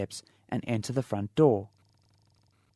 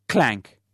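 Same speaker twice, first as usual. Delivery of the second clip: spoken clank; 8 dB louder than usual. Acted. Basin clatters.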